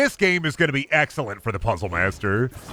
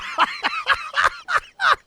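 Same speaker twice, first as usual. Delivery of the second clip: squeaky laughter falling away; high gleeful laugh. Real, not acted. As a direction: giggle in delight. Laughing after triumph.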